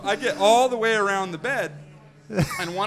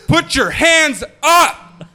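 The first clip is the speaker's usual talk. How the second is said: loud, slow, drawn-out yell of command; shouted order; 11 semitones higher, 12 dB louder, much slower than usual. Retold or imitated. Agent yelling at him during the raid, slow and loud. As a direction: bark each word like a raid officer.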